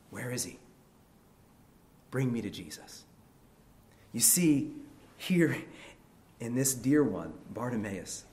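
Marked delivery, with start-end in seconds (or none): 0.1-3.0 subdued plea. Acted. Same plea, suddenly dropped in volume.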